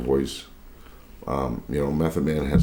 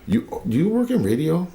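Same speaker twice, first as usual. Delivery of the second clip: much higher-pitched retold question; high voice of an impressed shopper; imitating surprised stranger; 14 semitones higher than usual. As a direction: ask it high, as an amazed lady would.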